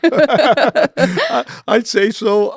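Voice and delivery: real laugh while answering; bursting into a laugh mid-reply. Real, not acted. Laughing at the interviewer's remark.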